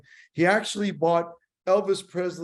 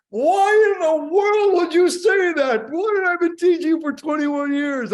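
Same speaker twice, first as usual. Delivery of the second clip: screaming; 13 semitones higher, 8 dB louder than usual. Retold or imitated. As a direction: scream it at him in outrage. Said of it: "He's screaming at me".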